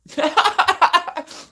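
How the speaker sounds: loud, high-pitched forced cackle; manic mock cackle. Mocking the meth head accusation, manic cackle. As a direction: cackle wildly at full volume.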